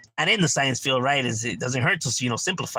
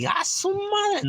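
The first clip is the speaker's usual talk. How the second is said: a much higher, slow, stretched-out gasp; mock shock; much slower than usual. Paying for a Disneyland water bottle, mock outrage. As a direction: drag it out high like he can't believe the price. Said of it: "I'm like".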